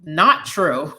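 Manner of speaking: ordinary talk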